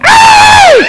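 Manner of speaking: high loud yelp of pain. Acted. Princess bitten in the knickers, high loud yelp. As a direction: yelp like something just bit you.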